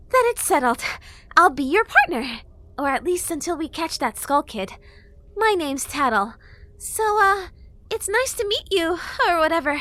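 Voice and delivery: high, loud cheerful fairy; bubbly, breezy little fairy; fairy girl voice. A narrator's reading. Fairy introduces herself.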